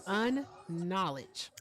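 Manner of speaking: "Unknowledge" stretched very slowly, falling tune; musing slowly. Real, not acted. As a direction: ponder "Unknowledge", dragging it out. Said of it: "trying to figure out what unknowledge is".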